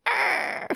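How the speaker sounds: high playful pirate "arr"; pirate growl. Pretend. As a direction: toss off a goofy pirate cry.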